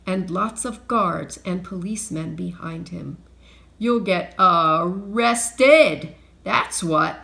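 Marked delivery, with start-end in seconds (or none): 3.8-7.2 loud, drawn-out triumphant threat; shout. A narrator's reading. Mister Dart to thieves with policemen behind him, shouting. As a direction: shout it slowly, stretching the key word. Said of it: "shouted Mister Dart".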